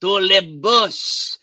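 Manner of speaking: put-on a deliberately poor French accent; bad French accent. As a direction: put on a clumsy French accent to greet listeners. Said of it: "I cannot do a French accent".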